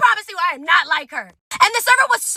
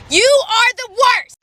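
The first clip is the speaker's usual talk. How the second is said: louder, very slow, each word hammered; slow emphatic; 6 semitones higher, 5 dB louder, much slower than usual. Real, not acted. Telling viewers off, slow and louder. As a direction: drag out every word for effect. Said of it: "emphatic verdict to audience".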